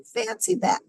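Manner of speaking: put-on very high old lady voice, amazed. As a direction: pipe it up like a wondering granny. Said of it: "said the little old lady".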